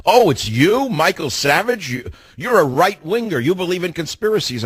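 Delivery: high-pitched, sing-song taunt; mocking. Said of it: "he started mocking me".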